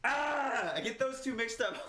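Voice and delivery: high exasperated wail; pissed off. Real, not acted. Podcaster confessing a name mix-up to co-host, high exasperated wail. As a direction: vent at yourself, high and frustrated. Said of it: "I'm so pissed off right now".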